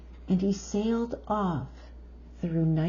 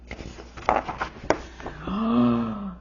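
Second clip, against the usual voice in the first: big delighted gasp; excited gasp; 4 semitones higher than usual. Acted. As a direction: gasp in wonder. Excited reading to child.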